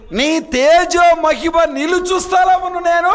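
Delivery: high, loud, slow recitation; preacher declaiming scripture; impassioned reading. Acted. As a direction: sing out the verse with fervour. Preacher reading verse aloud.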